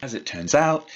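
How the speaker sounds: normal talking voice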